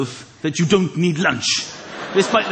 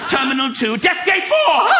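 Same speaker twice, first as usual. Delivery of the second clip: rising into a panicked scream; hammed-up airport panic ending in a scream; mock doom announcement; 9 semitones higher, 4 dB louder than usual. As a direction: announce the gate, then shriek in terror.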